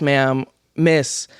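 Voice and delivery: ordinary delivery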